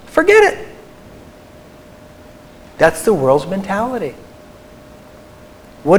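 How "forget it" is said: mock worldly voice. Speaker mocks the world's impatience in a high voice. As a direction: mimic the impatient world, pitched way up.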